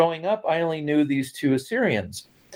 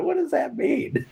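put-on laughing child's question; 14 semitones higher than usual.

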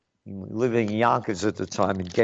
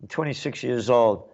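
normal talking voice

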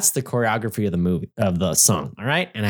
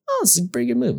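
lightly voiced, high-pitched pleased audience member; imitated moviegoer; 11 semitones higher, much faster than usual. Imagined viewer watching Grease in theaters, pleasantly approving.

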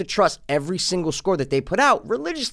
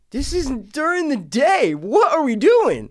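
mock incredulous outburst; 11 semitones higher, 3 dB louder, slower than usual. Mock outrage at movie lighting.

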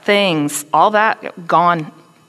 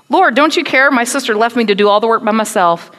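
loud, higher indignant complaint; Martha with attitude; 5 semitones higher, 7 dB louder than usual. Acted. Martha complaining to Jesus, loud and indignant. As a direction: demand it, full of attitude and hurt pride. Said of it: "Martha got some attitude here".

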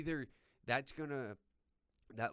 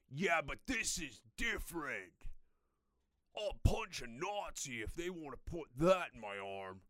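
mocking hypocrite; 4 dB louder than usual.

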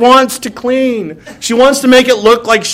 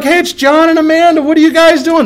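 higher, bright mock-delighted hello to friends; mock surprised greeting; 7 semitones higher than usual. Greeting unexpected friends John and Amanda, higher mock surprise. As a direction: act caught off guard but cheerful. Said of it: "And you're like".

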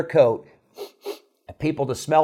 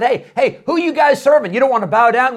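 high, loud questioning; demanding king; 10 semitones higher, 5 dB louder than usual. Retold or imitated. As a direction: press them hard for an answer. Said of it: "he said".

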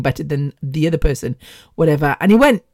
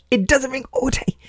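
put-on voice; 7 semitones higher, 5 dB quieter than usual. Repeats line in put-on voice.

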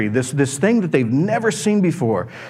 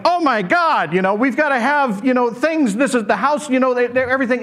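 high, flustered rush; a panicked host scrambling; Peter freaking out; 7 semitones higher than usual. Retold or imitated. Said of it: "freaks out".

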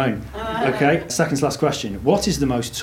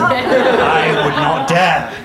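mock offended; 2 semitones higher, 5 dB louder, much slower than usual. Mock horror, slow, laughing.

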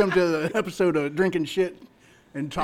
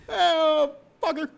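imitating a buddy; 10 semitones higher, 4 dB louder, much slower than usual. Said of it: "you can hear the end".

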